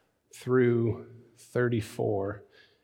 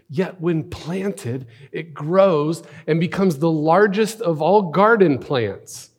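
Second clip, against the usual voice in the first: higher, slow, drawn-out wonder; storytelling scripture reading; 4 semitones higher, 4 dB louder, slower than usual. Acted. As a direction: savour the parable, hushed then swelling loud. Savours the parable on rereading.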